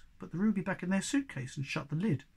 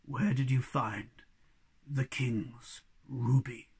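lower, slow, looming hobgoblin; 5 semitones lower, slower than usual. Acted. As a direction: speak like a brooding giant goblin.

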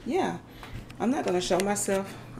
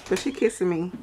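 ordinary talk

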